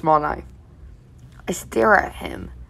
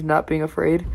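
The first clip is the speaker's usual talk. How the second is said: ordinary delivery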